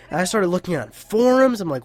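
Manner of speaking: ordinary delivery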